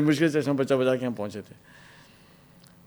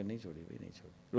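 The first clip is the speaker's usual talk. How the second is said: hushed pleading; 5 semitones lower, 21 dB quieter, faster than usual. Retold or imitated. Pleading reply under interrogation.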